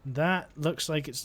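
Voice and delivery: usual speaking voice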